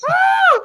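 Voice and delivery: very high, loud mock scream; imitated piercing rider scream; little-girl scream. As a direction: shriek like a little girl on a coaster. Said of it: "screaming like a little girl".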